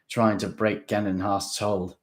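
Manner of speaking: usual speaking voice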